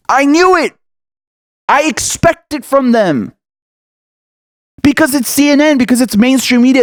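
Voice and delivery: high, heated, slowing to hammer each point; fed-up fury, pitched high; driven up the wall. Real, not acted. Vlogger fuming at CNN for smearing Bernie, angry and high. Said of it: "This shit drives me up the wall".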